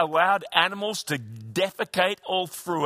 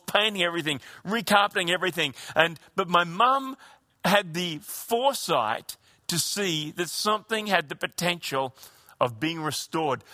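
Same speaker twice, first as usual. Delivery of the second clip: ordinary talk, nothing marked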